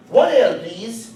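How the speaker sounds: mock Irish pub order; high opening in a put-on Irish brogue; Irish accent. Irishman ordering at the bar, Irish accent. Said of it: "That's my Irish accent".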